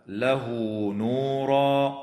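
slow recited "له نورا" with stretched pronoun vowel; silah. Shown as a demonstration. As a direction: lengthen "له" to "lahuu" before "نورا". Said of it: "فحكمها الصلة". (صلة هاء "له" بمد حركتين)

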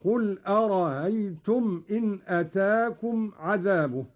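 أرأيتم with the second hamza eased into a glide; softened second hamza. Shown as a demonstration. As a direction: recite أرأيتم as ara-aytum with no hard catch before ay. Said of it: "بتسهيل الهمزة الثانية". (تسهيل الهمزة الثانية في أرأيتم)

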